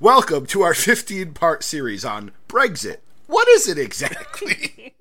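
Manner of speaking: loud mock announcement breaking into a laugh; mock announcer, laughing. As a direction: pretend to host a grand series, then crack up.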